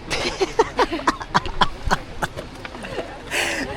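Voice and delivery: long high breathy laugh, real. Real, not acted. Vlogger laughing at paying to take photos, high laugh.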